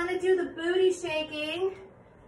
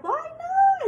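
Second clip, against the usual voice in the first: playful high exclamation; 14 semitones higher, 4 dB louder than usual. Real, not acted.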